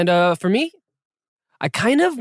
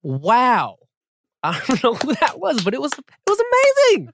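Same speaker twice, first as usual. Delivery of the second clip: excited; 5 semitones higher, 5 dB louder than usual. Real, not acted. Host gushing after hearing the song.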